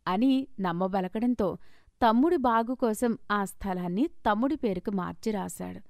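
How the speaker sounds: plain reading voice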